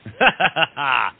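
big open laugh; amused laugh at his friend's complaint; hearty laugh. Acted. Lafayette laughs at Cyrano.

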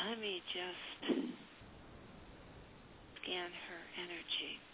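slow, breathy whisper to herself; hushed whisper. Real, not acted. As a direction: murmur it quietly while concentrating. Psychic pauses to read energy.

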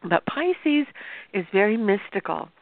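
normal talking voice

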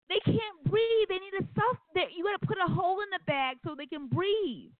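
put-on high-pitched, hysterical little-kid plea; screaming child. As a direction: imitate a distraught child wailing for the dolls' rescue. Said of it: "I was screaming like he was beating me".

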